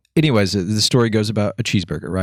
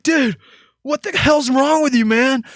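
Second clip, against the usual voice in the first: upset friend. Retold or imitated.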